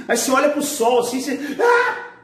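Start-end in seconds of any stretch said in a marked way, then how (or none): 1.6-2.1 high, sudden put-on "ah" of a sneeze; acted sneeze outburst; mock sneeze. Shown as a demonstration. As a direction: burst into a pretend sneeze. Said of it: "Você espirra".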